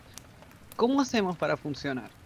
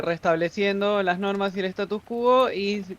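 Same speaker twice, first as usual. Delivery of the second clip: usual speaking voice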